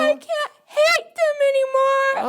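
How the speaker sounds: high, breaking voice through tears; crying. Real, not acted. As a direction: sob out the words. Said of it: "Have a good cry".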